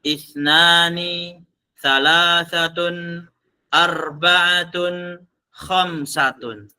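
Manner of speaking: each Arabic number drawn out slowly for students to repeat; slow, deliberate recitation of numbers; slow counting drill. Shown as a demonstration. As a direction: count them out slowly, one by one. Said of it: "Kemarin sudah kita hitung satu sampai lima".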